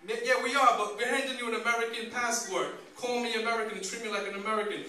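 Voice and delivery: re-enacted indignant objection; indignant retort. Retold protest to border officials.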